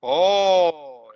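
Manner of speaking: drawn-out oh of wonder; amazed Spanish oh. Shown as a demonstration. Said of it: "when you are amazed, you say".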